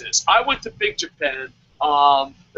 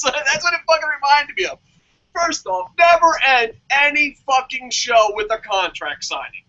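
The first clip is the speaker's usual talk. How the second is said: ordinary delivery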